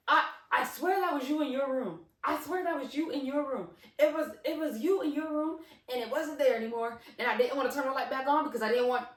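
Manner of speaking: high, loud, frantic insistence; animated imitation of a spooked boy; animated cousin. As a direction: plead your case, worked up and repeating yourself. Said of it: "he's real animated. So this is really him".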